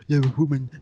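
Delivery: swooning simp; mock simp voice. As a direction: gush like a lovesick simp. Mocking simps over female owner.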